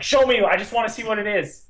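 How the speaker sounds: exasperated mock outburst; frustrated viewer. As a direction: plead with the screen, fed up. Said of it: "I was like".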